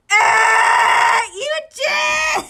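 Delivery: loud, high, slow cry; startled shout. Acted. Okawa crying out as towels hit his face, shouting. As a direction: yelp his friend's name.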